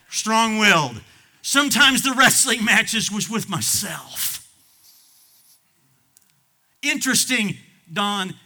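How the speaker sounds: high, loud testimony; preaching at full force. Acted. Pastor preaching his own trials, loud. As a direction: cry it out.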